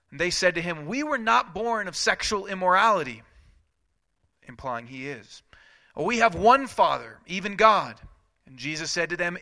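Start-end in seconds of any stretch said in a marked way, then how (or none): 0.8-8.0 higher, louder offended retort; indignant crowd. Acted. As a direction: fire back insulted.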